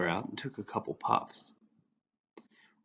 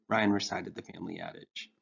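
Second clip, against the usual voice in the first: ordinary delivery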